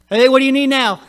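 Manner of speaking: loud, high, put-upon reply; hammed-up irritated demand; mock exasperation. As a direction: snap it like a fed-up parent. Imagined exasperated God answering a request.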